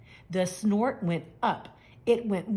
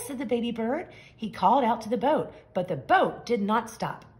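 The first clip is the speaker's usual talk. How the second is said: plain reading voice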